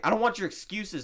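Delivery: high, loud mock indignation; T-Bone, angry. Villager refuses the player's coming apology, angry. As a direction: cut them off like an offended villager.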